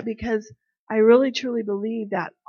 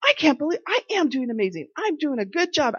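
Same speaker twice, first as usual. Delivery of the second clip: excited disbelief; 7 semitones higher than usual. Retold or imitated. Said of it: "she kinda stood up and she goes".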